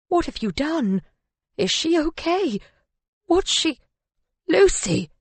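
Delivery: high-pitched, panicked questions; alarmed. A narrator's reading. Husband finding his wife on the ground, alarmed.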